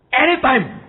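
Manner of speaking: high, loud emphatic cry; anytime, thrown out loud. Real, not acted. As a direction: shout the word out. Emphatic sermon exclamation.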